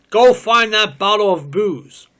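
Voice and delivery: high loud shout; shouted order. Acted. Woman shouting at man to fetch the booze, loud. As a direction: bark the order at him.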